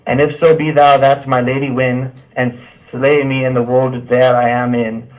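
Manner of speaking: usual speaking voice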